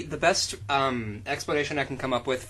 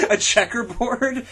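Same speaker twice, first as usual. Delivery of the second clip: mock incredulous; 7 semitones higher, 4 dB louder, slower than usual. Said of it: "mocking the fanfic's drama".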